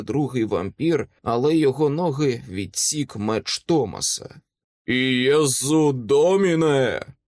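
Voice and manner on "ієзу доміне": long, drawn-out holy invocation; slow, sonorous shouted prayer; ringing battle cry. A narrator's reading. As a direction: ring out like a brass army bugle. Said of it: "пролунав голос Майкла ... мідною армійською сурмою".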